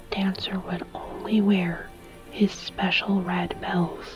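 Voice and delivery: usual speaking voice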